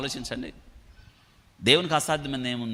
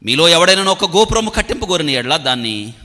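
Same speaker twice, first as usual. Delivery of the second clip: loud, raised scripture reading; 4 semitones higher, 9 dB louder than usual. Real, not acted. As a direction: read the verse out forcefully to a congregation. Reads scripture aloud.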